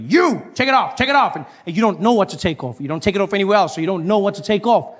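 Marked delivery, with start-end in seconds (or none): 0.1-1.4 barking security. Retold or imitated. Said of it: "They're like".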